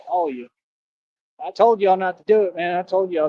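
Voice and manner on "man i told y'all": usual speaking voice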